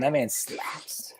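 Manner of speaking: breathy whisper of awe. Real, not acted. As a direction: whisper it like a secret. Hushed praise of Beethoven.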